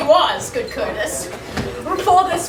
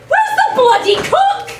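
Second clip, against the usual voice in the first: high, loud laughing yell; shouting for the cook; 13 semitones higher, 4 dB louder than usual. Acted. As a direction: bellow for the cook through laughter. Grumio shouting for the servants.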